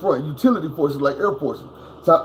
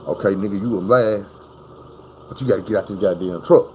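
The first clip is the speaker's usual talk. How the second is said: calm self-talk; 9 semitones lower than usual. Retold or imitated. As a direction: talk yourself down after the crash. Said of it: "I'm calm and I'm relaxed".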